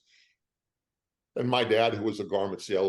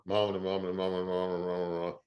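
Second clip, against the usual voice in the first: imitated phone murmur; 2 semitones lower, 3 dB quieter than usual. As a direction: mumble a run of muffled phone talk.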